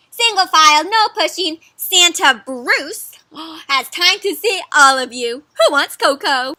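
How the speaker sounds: loud, high crowd-control calls; drawn-out, punchy storybook announcement; bossy little mouse. Acted. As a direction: announce it like a tiny usher. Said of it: "says one of the little mice".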